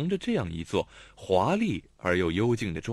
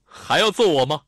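sarcastic jab; 5 semitones higher, 9 dB louder than usual. A narrator's reading. Xiao Tong retorts sarcastically.

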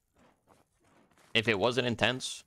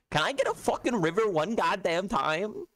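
exasperated begging; 9 semitones higher than usual. Real, not acted. Streamer begging the game for a river, exasperated pleading. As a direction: beg for it, pitched way up. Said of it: "I'm literally begging".

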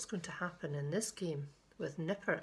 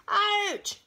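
high, loud storybook ouch; acted cry of pain; 11 dB louder than usual. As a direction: yelp it out high and sudden. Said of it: "he cried".

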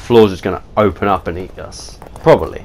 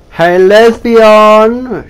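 calling out; 9 semitones higher, 7 dB louder, much slower than usual. Real, not acted. Calling out to character.

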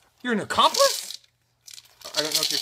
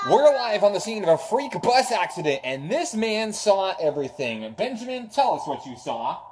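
breaking-news reporter voice, a bit louder; mock field reporter; 4 semitones higher, 5 dB louder than usual. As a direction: play the on-scene TV reporter. Pretend reporter at scene.

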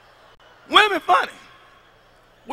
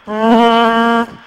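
mock fart sound; 7 dB louder than usual. Woman's fart sound mimicked.

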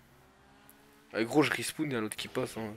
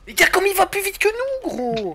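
high outraged shout; 5 dB louder than usual. Real, not acted. Outraged at rival's speed.

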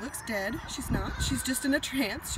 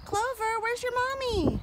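higher cooing question; high pet talk; 13 semitones higher, 3 dB louder than usual. Real, not acted. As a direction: coo at the little gosling. Calling to a gosling.

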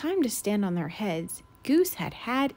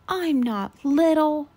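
louder, higher indignant holler; 5 semitones higher, 7 dB louder, slower than usual. Acted. Thistle hollering at Goose, loud and indignant. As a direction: yell it back, offended. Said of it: "Thistle hollered".

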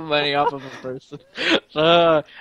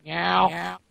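ordinary talk

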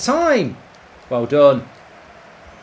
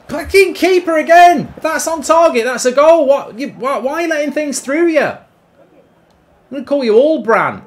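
higher, louder outburst at the keeper; furious yell; 7 semitones higher, 6 dB louder than usual. Real, not acted. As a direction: shout at your useless keeper. Yelling at his goalkeeper.

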